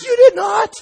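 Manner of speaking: very high, loud shriek of disbelief; hyper lady. Retold or imitated. Hyper lady from the fellowship disputing the casserole, shrieked disbelief. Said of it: "that's how she always talked".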